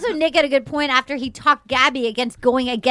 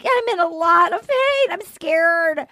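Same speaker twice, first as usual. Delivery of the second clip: mock pained whine; 5 semitones higher, slower than usual. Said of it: "She's like".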